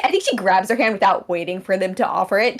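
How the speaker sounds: ordinary talk, nothing marked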